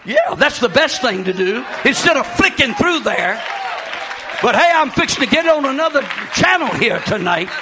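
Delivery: loud, high pulpit shouting; preaching at full force. Acted. Pastor shouting warnings at his flock about the internet. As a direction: preach it hard to the congregation.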